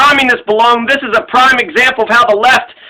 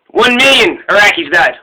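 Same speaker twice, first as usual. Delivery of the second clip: ordinary delivery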